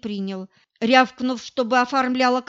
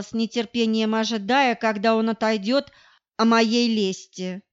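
ordinary delivery, nothing marked

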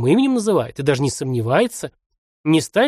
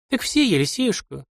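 tearful; 6 semitones higher than usual. A narrator's reading. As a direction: answer through a light sob. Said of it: "снова всхлипнула женщина".

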